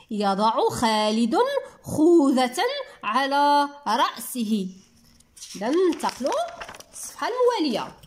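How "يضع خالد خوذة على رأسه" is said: slow reading with a pause after each phrase; slow, careful model reading for children; word by word. Shown as a demonstration. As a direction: read يضع خالد / خوذة / على رأسه in separate chunks. Same sentence read word by word.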